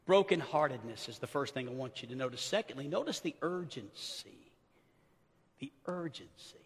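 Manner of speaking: almost whisper the headings; hushed sermon point. Acted. Preacher quietly announcing his sermon points, hushed.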